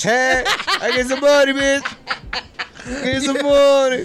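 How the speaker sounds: hyped up. Pretend. Hyped bragging in accent, laughing.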